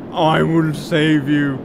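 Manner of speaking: slow, loud heroic declaration. Pretend. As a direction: play the gallant rescuer slowly. Heroic mock line while flying.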